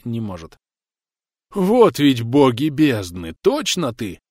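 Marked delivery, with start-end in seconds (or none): 1.5-4.2 slow, louder amazed exclamation; surprised drunk. Acted. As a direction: marvel at him, drunk. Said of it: "Укс удивлённо покачал головой".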